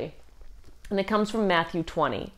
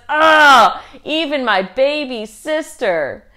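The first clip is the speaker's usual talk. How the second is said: loud disgusted groan, slow; horrified Charlie Brown; 6 semitones higher, 9 dB louder, slower than usual. Retold or imitated. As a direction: drag it out in mock horror. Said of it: "Charlie Brown is horrified".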